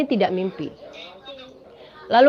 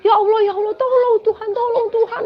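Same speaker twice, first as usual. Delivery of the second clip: high tearful begging, much higher; frightened crying plea; 10 semitones higher, 4 dB louder than usual. Retold or imitated. Trapped dreamer begging God for help, crying. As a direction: cry out to God in panic. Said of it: "kita semua ketakutan".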